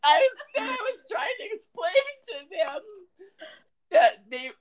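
laughing through the words; laughing hard. Real, not acted. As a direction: crack up while telling it.

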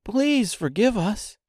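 put-on high, slow pleading girl. A narrator's reading. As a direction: plead gently for forgiveness. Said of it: "returned Dorothy".

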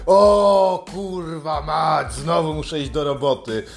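slow, dragged-out groan of a sulking worker; mock whiner. Sulky worker dreading going to work, mock whining. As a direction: whine it like a self-pitying slacker.

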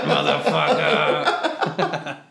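very low slow growled dog voice; growling dog voice. Acted. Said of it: "that's a savage dog voice".

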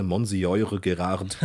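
Monsieur Gérard read letter by letter, as Mon-si-eu-re Ge-rard; mock-German spelling pronunciation of Monsieur Gérard; absurdly Germanised French. Shown as a demonstration. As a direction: say Monsieur Gérard as if it were German, hard G and sounded final D. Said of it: "natürlich nicht absurderweise".

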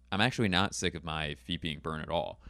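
ordinary talk

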